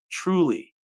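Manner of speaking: ordinary talk, nothing marked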